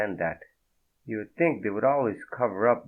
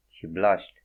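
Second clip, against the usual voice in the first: ordinary delivery, nothing marked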